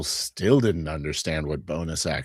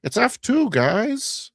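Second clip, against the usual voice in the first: high squeaky nerd announcing; excited game master; 3 dB louder, slower than usual. Retold or imitated. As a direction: squeal the result at the table. Game master voiced announcing treasure roll.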